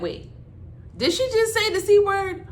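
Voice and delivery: high, loud disbelieving gasp of a question; a boss who can't believe her ears; shocked. Retold or imitated. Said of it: "She was like".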